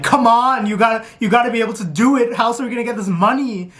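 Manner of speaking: high, fast exasperated pleading; mock frustration. Competitive viewer urging a friend to win the money, mock exasperation.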